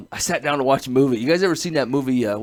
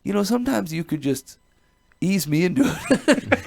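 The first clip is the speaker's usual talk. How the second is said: mild comic complaint; 6 semitones higher than usual. Retold or imitated. Man praying with eyes closed, comically put-upon. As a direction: plead upward, drawing out the last words.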